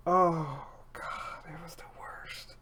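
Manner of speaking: whispered horror. Real, not acted. Recalling the dick pic, hushed whisper. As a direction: breathe it out in a whisper.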